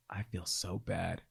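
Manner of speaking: soft, slow remorseful dad; sad. Retold or imitated. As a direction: say it with regret.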